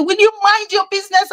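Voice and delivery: high, quick mock retort; fast, high-pitched irritated comeback; retold rebuff. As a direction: imitate an annoyed man telling someone off. Angry father rebuffs her.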